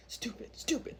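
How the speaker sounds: whispered, annoyed mock insult; very soft imitation of a scolding mom; muttered scolding. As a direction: mutter it under your breath as a cross mom. Mom scolding daughter under her breath.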